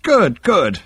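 high, pleased therapist voice; beaming. A narrator's reading. Psychiatrist hearing the mission report, beaming. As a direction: say it through a beaming smile. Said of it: "beamed Halfront".

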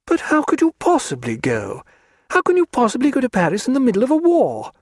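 a spirited lady mocking her hostess; Diana's voice. A narrator's reading.

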